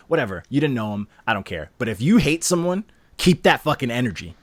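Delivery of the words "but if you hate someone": ordinary talk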